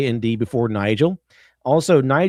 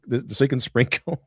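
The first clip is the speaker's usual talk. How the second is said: ordinary talk, nothing marked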